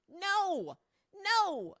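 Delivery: loud, high shouted no; emphatic no. Real, not acted.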